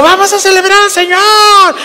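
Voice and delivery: high, mock showy announcer; hammed-up worship leader; hyped worship leader. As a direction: pump up the crowd like a showman. Worship leader hyping the crowd.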